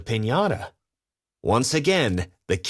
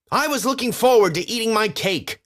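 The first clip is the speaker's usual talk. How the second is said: yelled; 11 semitones higher, faster than usual. A narrator's reading. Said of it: "he yelled".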